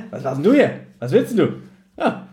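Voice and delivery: usual speaking voice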